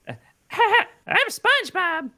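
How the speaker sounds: a terrible try at SpongeBob's voice; bad SpongeBob impression. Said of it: "I can't do SpongeBob".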